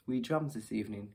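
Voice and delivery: ordinary talk, nothing marked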